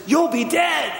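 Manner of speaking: high, loud threatening yell; hammed-up alien thug shouting a death threat; menacing shout. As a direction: bark the threat at him. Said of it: "grabs him and looks Luke right in the eye".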